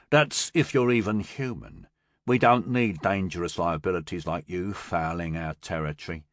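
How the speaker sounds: a low, cold thug warning off a stranger; cold gang boss. Acted. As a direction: talk down to him like a threat. Peric's voice in the story.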